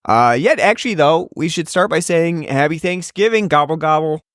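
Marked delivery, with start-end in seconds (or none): 3.5-4.2 gobbling turkey voice. Acted. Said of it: "that's my turkey impression".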